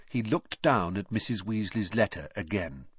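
ordinary delivery, nothing marked

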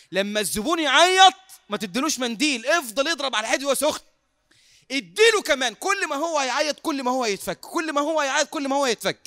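high, loud, animated urging; rousing mock coaching. Preacher joking about working on the crier, loud and urging.

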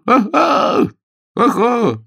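high, loud whooping exclamation; very high merry hoot; gleeful agreement. A narrator's reading. Guest merrily agrees to celebrate.

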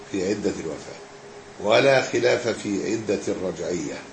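measured scholarly reading with long pauses; formal text reading. Acted. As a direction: read it out phrase by phrase, letting endings drop. Reads the book aloud.

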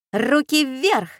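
put-on high-pitched girl's order; commanding. A narrator's reading. Girl with a pistol orders the villainess, commanding. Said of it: "скомандовала Катя".

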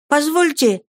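put-on high-pitched boyish request; timid plea. A narrator's reading. Frightened pupil begging leave, high-voiced. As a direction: ask permission in a high voice. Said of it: "заикаясь".

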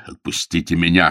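usual speaking voice

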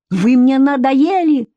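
high, drawn-out exasperated cry; mildly acted outburst; snapping, fed up. A narrator's reading. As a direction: lose patience, pitch climbing. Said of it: "Тоже сорвалась она".

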